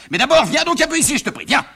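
high-pitched, impatient father; exasperated summons. Acted. Father summons son angrily.

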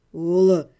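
low, steady and level; calm, even voice. A narrator's reading. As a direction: cut through her panic calmly. Necromancer calm.

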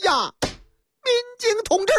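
high pleading woman's voice; wailing woman caller. Acted. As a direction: whimper like a frightened woman.

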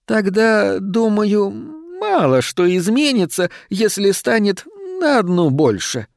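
high, plaintive entreaty; pleading. A narrator's reading. Shadow begs the old lady to take it in, high and pleading. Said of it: "с мольбой произнесла тень".

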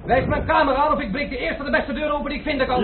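high, forceful threat to break doors; bully the innkeeper into obeying; angry demand. Acted. Angry guest threatening the innkeeper, high and forceful.

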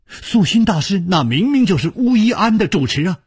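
high, urgent protest; agitated insistence. A narrator's reading. Agitated man insisting to bewildered nun.

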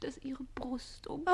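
breathy, hushed tease; teasing whisper. Acted. Susanne teasing the page, breathy whisper. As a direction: whisper it to tempt him.

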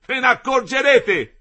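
loud, high, slow shout; yelled threat. A narrator's reading. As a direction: yell the warning. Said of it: "urlò".

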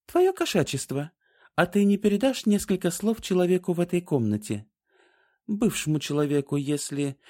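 high, sweet wheedling voice; butter up the cat; sweet pleading. A narrator's reading. Trian coaxing the cat.

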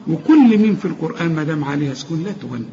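ordinary delivery

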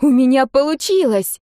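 high-pitched astonished exclamation; amazed girl. A narrator's reading. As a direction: marvel at your own success. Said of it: "ошарашенно произнесла девочка". (ошарашенный возглас девочки)

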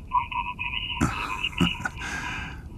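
breathy, high chuckle; smug quiet laugh; sly laugh. Acted. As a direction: laugh at his own leverage. Villain on phone.

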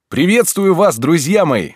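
high, bright eccentric teacher voice; joyful greeting. A narrator's reading. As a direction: greet the class beaming with delight. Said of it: "раздался радостный голос". (радостное приветствие)

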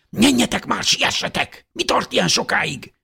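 hiss-shout them to hurry up; half-loud urging call. Acted. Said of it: "kiáltotta Elizabeth nénje félhangosan". (félhangos, sürgető kiáltás)

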